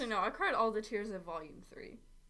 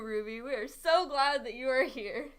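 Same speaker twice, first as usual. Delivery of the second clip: normal talking voice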